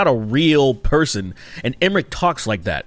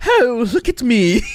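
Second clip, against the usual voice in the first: high, hammed-up posh preening; posh gentleman; 10 semitones higher than usual. Imagining the scary lich preening posh, hammed-up. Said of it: "his Disney-esque performative nature".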